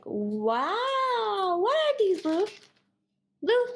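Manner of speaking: voicing amazed parrot. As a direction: play the bird gawking at treats. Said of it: "Blue's looking at it like".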